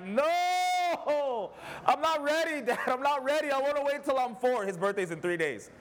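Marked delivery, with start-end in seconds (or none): 0.0-3.5 put-on high, far child's plea, crying in fear; scared little boy. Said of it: "He almost fell on the floor crying of fear". 3.5-4.6 put-on high child's pleading whine; scared little boy. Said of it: "crying of fear".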